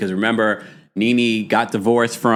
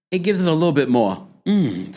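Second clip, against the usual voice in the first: normal talking voice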